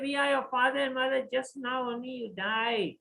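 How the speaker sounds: quick loud pleading; urgent insistence. Acted. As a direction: rush to convince him. Parents insist to their son.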